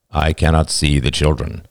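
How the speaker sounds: low, even, emotionless voice; impassive angel. A narrator's reading. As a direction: state it deep and without feeling. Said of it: "said the angel impassively".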